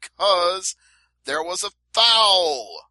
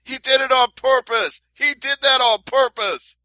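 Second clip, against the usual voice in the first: loud, high protest at the referee; acted outraged shout, pitched way up; imitated yelling; 10 semitones higher, 7 dB louder than usual. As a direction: yell it like a wronged fighter complaining. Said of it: "starts yelling at the referee".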